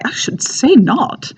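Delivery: high, very proper RP Englishman. Acted. Showing the crisp, clean man in the comic, proper high-pitched RP. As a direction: play the crisp, proper RP gentleman. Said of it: "more as the R P guy".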